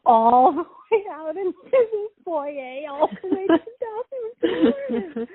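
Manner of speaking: high, drawn-out words through real laughter; breaking into laughter. Real, not acted. Telling a friend about the toilet paper trail, breaking up laughing. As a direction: struggle to get it out while laughing.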